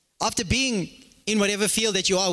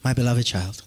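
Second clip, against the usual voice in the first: lower, overly sweet parent's voice; much lower mock parent calling "my beloved child"; mock tender parent; 10 semitones lower than usual. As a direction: ham up a saintly gentle parent. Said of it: "parents don't talk like that".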